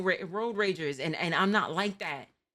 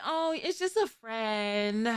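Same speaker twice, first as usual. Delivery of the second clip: coy friend voice; 4 semitones higher, slower than usual. Retold or imitated.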